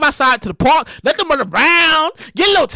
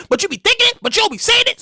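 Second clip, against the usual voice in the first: normal talking voice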